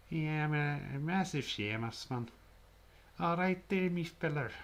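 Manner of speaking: high, lilting mock Irish; Irish accent. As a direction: put on a cartoon Irish brogue. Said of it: "Oh, my Irish, though".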